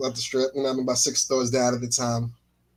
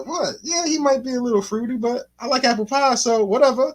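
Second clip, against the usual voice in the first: friend's voice pitched up, shrugging; a hint of a friend shrugging it off; mock friend; 9 semitones higher than usual. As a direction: toss it off in a higher voice. Impersonating friend.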